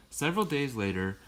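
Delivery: ordinary delivery, nothing marked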